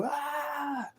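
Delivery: high piercing mock shriek, far above his voice; mock demonic scream. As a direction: imitate a demon's scream in one sharp burst. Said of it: "let out a demonic scream".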